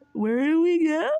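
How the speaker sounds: high, loud mocking ditzy voice; hammed-up clueless question; mocking airhead voice.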